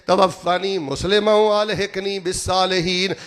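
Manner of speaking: high, ringing supplication with stretched Arabic phrases; fervent prayer. Acted. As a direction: plead the prayer out, pitched high. Prays, reciting and translating. (ਉੱਚੀ ਸੁਰ ਵਿੱਚ ਦੁਆ)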